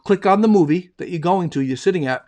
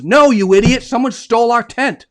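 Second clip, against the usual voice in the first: higher, louder scolding Sherlock; exasperated punchline; 6 semitones higher, 7 dB louder than usual. Acted. Sherlock scolding Watson for missing the stolen tent, loud. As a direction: snap the punchline at him.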